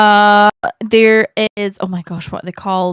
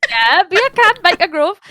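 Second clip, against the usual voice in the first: high, loud Geordie accent; Newcastle accent; 9 semitones higher, 4 dB louder than usual. Shown as a demonstration. Guest finishing her Newcastle accent for the host. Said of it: "the extent of my Newcastle accent".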